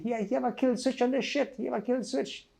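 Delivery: high, lightly voiced attacker; imitating attacker. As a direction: mimic the man finding the kill switch, high. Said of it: "I hear somebody say".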